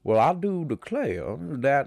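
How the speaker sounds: put-on slow, stretched old Southern gentleman; lightly hammed-up old Southern drawl; old Southern accent. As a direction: savor each word like a genteel planter. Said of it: "He's like".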